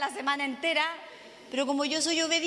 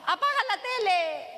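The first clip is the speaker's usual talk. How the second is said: higher mock shout of an order; mock yell; 6 semitones higher than usual. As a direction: bark it like a fed-up mum. Mother yelling at child quoted.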